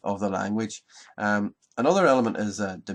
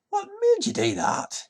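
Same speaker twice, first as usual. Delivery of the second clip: a sudden squeak, far higher than the narration; indignant farmer; 4 dB louder than usual. Acted. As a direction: McCammon demanding an answer, voiced comically high. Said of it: "he says to the boy".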